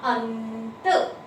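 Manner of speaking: very slowly stretched अंत, nasal held long; drawn-out answer. Shown as a demonstration. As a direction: draw अंत out for children. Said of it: "क्या बना?".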